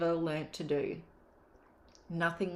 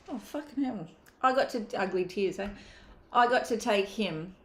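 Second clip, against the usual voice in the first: higher-pitched, holding back real tears; getting teary; 4 semitones higher than usual. Real, not acted. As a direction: choke up with real tears as you tell it. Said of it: "this is why I'm getting teary".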